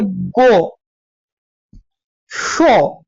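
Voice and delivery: गो pitched low, then शो; example word demo. Shown as a demonstration. Teacher sounding out example words for students. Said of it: "आवाज ऊपर की तरफ जा रही है".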